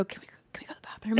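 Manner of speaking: quick whispered plea; hushed, hurried whisper imitating herself; urgent whisper. Stoned woman whispers to her friend for a bathroom escape, urgent whisper.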